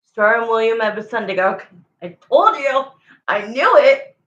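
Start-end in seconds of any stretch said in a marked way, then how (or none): 2.0-4.2 triumphant burst, louder and higher; excited. Real, not acted. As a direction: blurt it out like you called it. Psychic's guess confirmed, exclaims.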